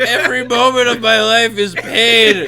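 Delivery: high, loud tortured lament; tormented creature voice. Acted. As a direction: moan it like a cursed monster.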